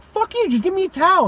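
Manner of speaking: high-pitched indignant demand; worked-up retold complaint; retold exasperation. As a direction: demand it in mock outrage. Retold argument with dad.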